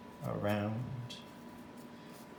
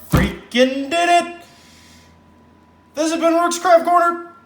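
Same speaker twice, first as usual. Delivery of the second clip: much higher, loud outro shout; excited shout; 12 dB louder than usual. Real, not acted. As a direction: yell it out with glee.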